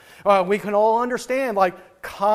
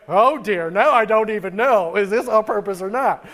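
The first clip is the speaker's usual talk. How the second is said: louder, exasperated and laughing; mock exasperation; 7 dB louder than usual. Pastor gets another all-caps email, loud mock exasperation. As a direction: throw up your hands in mock despair. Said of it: "I'm like".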